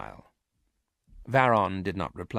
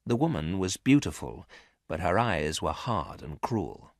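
ordinary delivery, nothing marked

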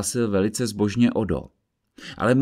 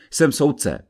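firmly; 6 semitones higher, 4 dB louder than usual. Acted. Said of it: "odvětil jeho přítel pevně".

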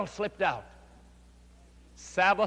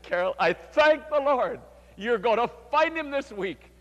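joyful insistence; 6 semitones higher, 4 dB louder than usual. Retold or imitated. Pastor smiling while she refuses.

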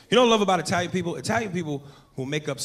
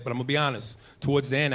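ordinary delivery

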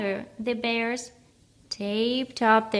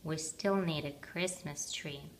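lower, softer huff; huffy bear; 5 semitones lower, 8 dB quieter than usual. A narrator's reading. Big Bear huffs at Teddy, lower and softer. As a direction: huff it out low. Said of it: "huffed Big Bear".

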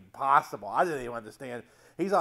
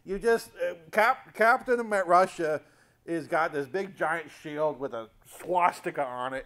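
ordinary delivery

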